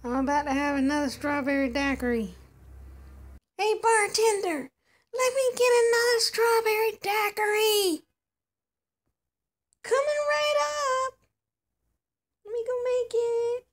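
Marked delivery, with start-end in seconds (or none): 3.6-8.0 high, loud call across the bar; raised voice ordering a drink. Acted. Calling out to the bartender. 9.8-13.7 lightly voiced high bartender. Bartender doll answers.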